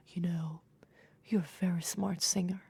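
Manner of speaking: hushed and breathy, unhurried. Retold or imitated. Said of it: "very breathy voice".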